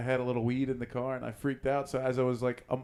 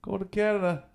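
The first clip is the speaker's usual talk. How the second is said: halting, higher-pitched nervous answer; 7 semitones higher than usual. Retold or imitated. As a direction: imitate a hesitant traveler at the border. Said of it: "You nervous?".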